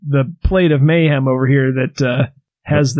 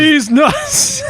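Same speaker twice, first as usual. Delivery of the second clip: high joking exclamation; 12 semitones higher than usual. Acted. Punchline joke shout.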